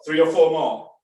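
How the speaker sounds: ordinary talk, nothing marked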